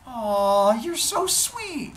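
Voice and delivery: very high, slow, smitten wife; gushing. Acted. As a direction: coo it high, stretching every word. Wife charmed by "romantic.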